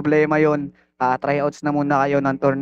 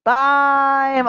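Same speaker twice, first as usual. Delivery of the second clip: shouted call; 10 semitones higher than usual. Real, not acted. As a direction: shout it out to end the round. Calls time after rap verse. (malakas na sigaw)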